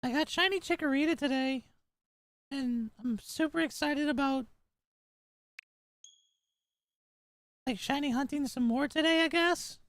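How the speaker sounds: put-on squeaky, overexcited little kid; annoying anime kid voice. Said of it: "This voice the whole time?".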